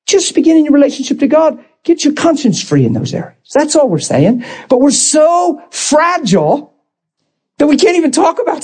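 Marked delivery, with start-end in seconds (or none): none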